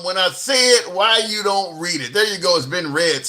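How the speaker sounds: usual speaking voice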